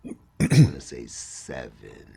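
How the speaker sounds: ordinary delivery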